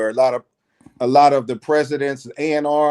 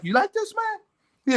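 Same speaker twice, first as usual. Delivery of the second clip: much higher, rising question; high-pitched quoted query, lightly acted; imitated casual offer; 5 dB quieter, slower than usual. Teller as Bobby offering his cologne, high questioning voice.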